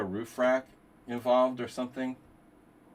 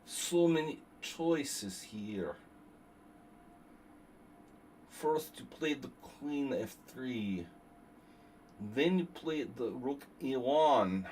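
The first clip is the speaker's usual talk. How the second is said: mock chess doctor. Streamer playing Doctor Nef Idov explaining moves in a mock lecture voice. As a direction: explain the moves like a pompous professor. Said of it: "Doctor Nef Idov".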